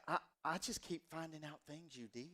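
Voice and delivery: lightly imitating his mom's Southern accent. Mom complaining about her sneaky son, in a soft Southern accent. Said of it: "every time I do my mom's accent".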